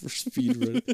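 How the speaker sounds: high mock echo of the words. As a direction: parrot it back in a high teasing voice. Mocking friend's line.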